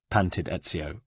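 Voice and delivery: ordinary delivery, nothing marked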